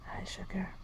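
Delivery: soft unvoiced whisper. Real, not acted. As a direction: whisper the greeting gently. Whispering to puppy.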